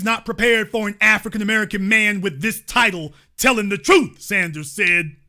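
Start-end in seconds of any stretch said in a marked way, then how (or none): none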